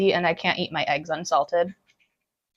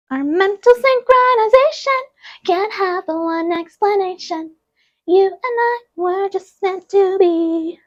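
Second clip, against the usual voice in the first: high-pitched, drawn-out movie princess voice; Anna voice; 10 semitones higher, slower than usual. Acted. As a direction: quote the film in a high, slow princess voice. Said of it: "That's my Anna voice".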